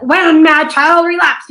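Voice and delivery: high, loud, slow pained delivery; hurt, emotional. Shown as a demonstration. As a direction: let the hurt pour out, slowly. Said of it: "he's really hurt".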